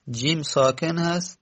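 ordinary talk

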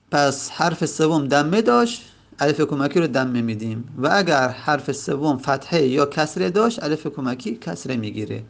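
ordinary delivery

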